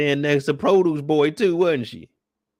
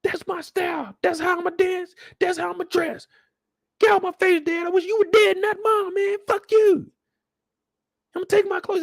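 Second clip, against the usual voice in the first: much higher, shouting mock teen; furious son. Son screaming hatred at his father, high shout. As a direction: yell it at him.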